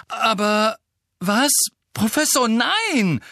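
high, loud outraged cry; enraged protest. Acted. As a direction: burst out at the injustice. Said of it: "sagte Harry zornentbrannt".

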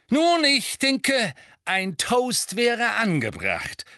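slow, high, genial toast voice; kindly father raising a toast; Mr Weasley. Acted. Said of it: "sagte Mister Weasley".

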